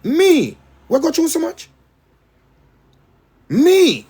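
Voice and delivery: high-pitched incredulous plea to the Almighty; imitating tearful disbelief. Retold prayer of disbelief to the Almighty, tearfully high. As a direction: cry it out as if you can't believe you were chosen. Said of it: "Me used to cry".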